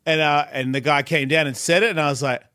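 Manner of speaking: ordinary talk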